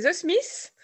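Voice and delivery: put-on a playful, slightly slow English accent. Guest names her chosen band on the radio in a mock English accent. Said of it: "avec mon super accent anglais".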